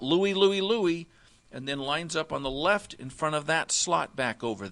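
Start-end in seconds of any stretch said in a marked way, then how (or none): 0.0-1.1 louder, higher demo shout of the call; yell out. Shown as a demonstration. Linebacker calling strength left, loud shout. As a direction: belt the call to the defense. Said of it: "yells out".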